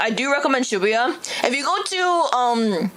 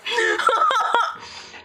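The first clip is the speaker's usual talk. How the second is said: high laughter; laughing; 11 semitones higher than usual. Real, not acted. Reacts to old voice clip.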